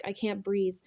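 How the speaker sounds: usual speaking voice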